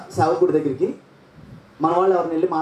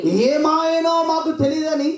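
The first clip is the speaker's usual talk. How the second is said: ఏమాయనో మాకు తెలియదని repeated high and slow, stressing ఏమాయనో; emphasised repeat; 12 semitones higher, much slower than usual. Shown as a demonstration. Preacher repeating the reader's line for the congregation, slow and high. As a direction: draw out ఏమాయనో slowly for weight. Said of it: "repeats the phrase".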